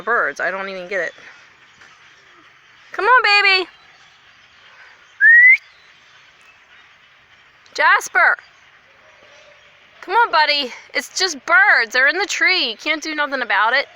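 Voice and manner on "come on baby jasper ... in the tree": loud, high coaxing to a dog; coaxing call. Real, not acted.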